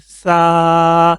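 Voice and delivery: sa said light and thin, no whistle. Shown as a demonstration. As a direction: clear thin سا sound. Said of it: "بالکل اس کو light کر کے پڑھیں گے".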